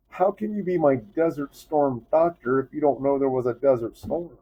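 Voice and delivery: high, incredulous challenge; worked-up indignant question; retold angry disbelief. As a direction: re-enact confronting the doctor, exasperated. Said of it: "I got so mad".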